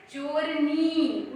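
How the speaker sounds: चोरनी said very slowly, syllable by syllable; slow pronunciation. Shown as a demonstration. Teacher pronouncing for children, very slow. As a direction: spell out चोरनी slowly for the class. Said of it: "उच्चारण करिएगा".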